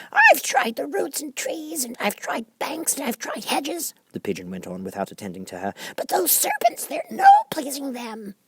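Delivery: very high bird voice, complaining; a fussy bird listing its troubles; high fretful pigeon voice. A narrator's reading. Pigeon complains about serpents.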